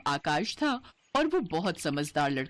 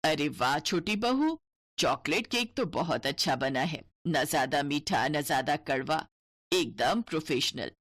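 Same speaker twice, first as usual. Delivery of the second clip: mother-in-law voice; 6 semitones lower than usual. Acted.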